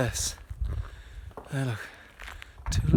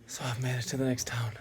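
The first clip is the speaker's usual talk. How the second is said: breathless whisper on the trail; hushed, winded words; 10 dB quieter than usual. Real, not acted. Whispering while trekking.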